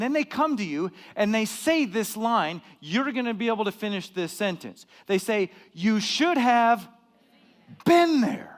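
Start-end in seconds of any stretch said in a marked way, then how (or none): 5.7-8.5 slow, high, drawn-out gloating; smug taunt. Retold or imitated. Preacher mocking a friend who went without him, smug. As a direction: rub it in, stretching every word. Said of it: "I hate that when people say that".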